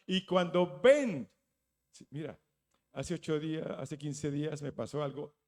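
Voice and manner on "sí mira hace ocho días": ordinary talk, nothing marked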